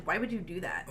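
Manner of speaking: soft, low disapproval; disapproving. Shown as a demonstration. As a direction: say it quietly, disapproving. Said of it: "I read it more as".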